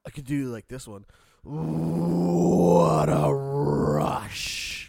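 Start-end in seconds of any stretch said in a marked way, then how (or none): 1.4-4.9 loud, slow gravelly wrestler yell; wrestler impression. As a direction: roar it like the Road Warriors. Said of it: "You know who that is?".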